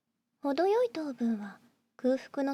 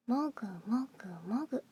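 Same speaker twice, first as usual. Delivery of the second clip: whispered chewing sound. Acted.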